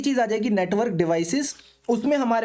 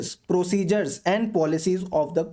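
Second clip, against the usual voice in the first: slow dictation; 4 semitones lower, much slower than usual. Shown as a demonstration. Dictating definition ending.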